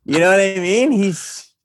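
high laugh, then laughing through words. Real, not acted. Laughs at own remark.

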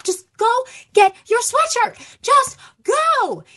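high-pitched exasperated parent snapping orders; re-enacted fed-up outburst, climbing to a shout; mad and done. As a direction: order the kid out, at the end of your rope. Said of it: "I was so fucking mad and done".

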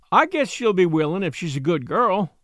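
ordinary delivery

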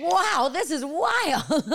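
high, slow, laughing wow; laughing amazement. Retold or imitated. Mother recalling her daughter's sigh, laughing in wonder. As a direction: marvel at her through laughter. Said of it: "I was like".

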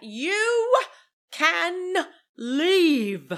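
high, drawn-out theatrical dismissal; Meredith. Retold or imitated. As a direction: pause between words like a grand diva. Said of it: "Meredith gives us the powerful".

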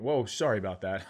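ordinary talk, nothing marked

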